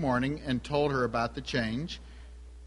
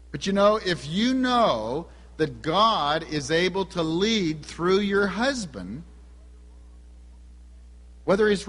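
fervent preaching; 3 semitones higher than usual. Acted. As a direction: lean into each phrase like a pulpit appeal. Preaching to the congregation.

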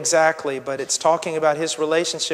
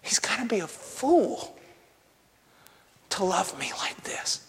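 hushed wife's wonder; 7 dB quieter than usual. Retold or imitated. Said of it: "look at us and go".

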